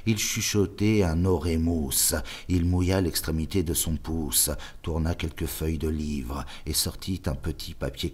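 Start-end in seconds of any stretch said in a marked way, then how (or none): none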